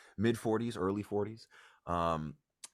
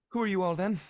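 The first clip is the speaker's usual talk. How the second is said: mother voice; 13 semitones higher, 9 dB louder, much faster than usual. Acted. Mother greets strangers.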